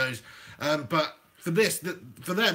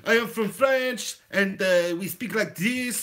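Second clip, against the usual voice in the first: slow, hammed-up French accent; comic Frenchman speaking English; slower than usual. Shown as a demonstration. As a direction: demonstrate a French accent deliberately. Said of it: "we're doing an accent".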